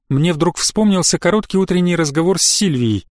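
ordinary delivery

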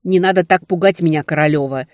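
forceful, alarmed scolding; loud retort. A narrator's reading. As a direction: throw it back forcefully at your friend. Alarmed friend snaps back in dialogue.

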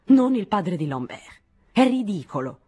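heated, scornful contradiction; indignant denial. A narrator's reading. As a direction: dismiss it hotly, leaning on each word. Flushed Nadine angrily rejects the idea.

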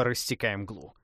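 plain reading voice

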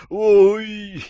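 loud high "oy"; pained cry. Acted. Captive punched over the liver, loud high yelp. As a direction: yelp from a sudden blow. Captive struck under the ribs.

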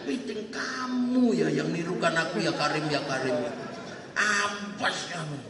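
slow, restrained acted threat; mock angry threat. Angry pilgrim grabbing the one who copies him, slow menacing warning. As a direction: threaten slowly, low at the end. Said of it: "Sambil marah".

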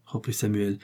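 usual speaking voice

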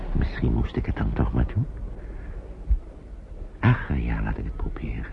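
half-whispered giving in to himself; resigned murmur. Acted. Man talks himself into the decision, resigned murmur. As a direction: sigh it out under the breath. Man deciding alone, thinking aloud.

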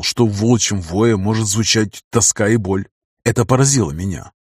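plain reading voice

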